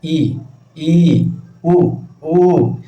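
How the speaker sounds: ई and उ each said short, then drawn out long; vowel length demo. Shown as a demonstration. Teacher showing students short and long vowels, by contrast. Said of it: "बोलने में ज़्यादा time लगता है".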